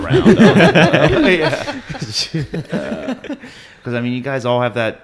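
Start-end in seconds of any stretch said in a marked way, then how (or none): 0.4-3.1 loud, higher laugh. Real, not acted. Laughing at memory.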